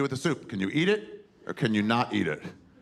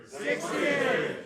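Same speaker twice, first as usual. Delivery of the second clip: audience shout. Real, not acted.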